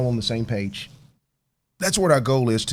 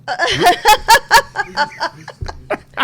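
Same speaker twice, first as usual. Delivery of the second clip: loud high laugh; 10 dB louder than usual. Real, not acted. Guest laughs at teasing.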